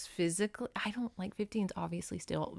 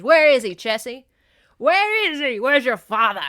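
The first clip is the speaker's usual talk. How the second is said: loud, higher demanding shout; Tony shouting to find Chessie's father; angry shout; 4 semitones higher, 11 dB louder than usual. Acted. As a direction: bark the questions at her like an angry hero. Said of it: "as best as I can act them".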